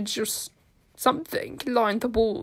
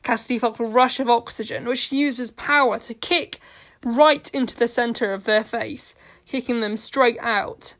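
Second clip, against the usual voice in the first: ordinary delivery, nothing marked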